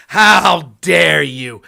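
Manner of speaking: loud slowed mock-indignant yell; hammed-up shouted how dare you. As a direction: bellow it to parody offended outrage. Mocking outraged opponent, shouted.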